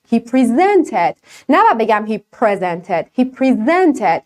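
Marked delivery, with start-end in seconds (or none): 0.1-1.1 He presented said very slowly, stress on ZEN; second-syllable stress. Shown as a demonstration. Scene: teacher models the verb for learners, slow second-syllable stress. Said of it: "تکیه من میره رو بخش دوم". 3.2-4.2 He presented said very slowly, stress on ZEN; second-syllable stress. Shown as a demonstration. Scene: teacher models the verb for learners, slow second-syllable stress. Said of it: "تکیه من میره رو بخش دوم".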